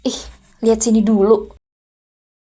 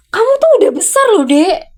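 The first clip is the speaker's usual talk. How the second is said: heated outburst; 8 semitones higher than usual. Acted. Aunt scolding, pitch jumps.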